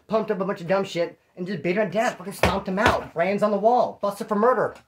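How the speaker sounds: normal talking voice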